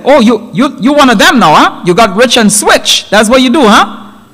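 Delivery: high-pitched, needling reproach; indignant accusation. Acted. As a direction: accuse your twin of selling out, offended. Brother accuses his judge twin.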